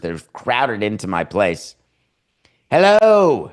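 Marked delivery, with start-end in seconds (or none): 2.7-3.5 loud, high call to an imagined crowd; loud hello. Pretend. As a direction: belt a greeting to the crowd. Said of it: "word association ... visitors".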